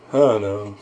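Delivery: low, soft mock dismay; reluctant dread. Retelling a partner's reaction to the idea, reluctant.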